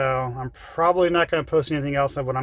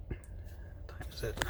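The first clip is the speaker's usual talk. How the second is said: whisper; 3 semitones lower, 18 dB quieter, much slower than usual. Real, not acted. Asking himself, whispered aside.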